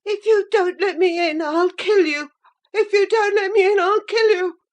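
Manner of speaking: mild shriek. A narrator's reading. Boy shut out at the fastened door, shrieking in frantic fury. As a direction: shriek it with some restraint. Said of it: "he rather shrieked than said".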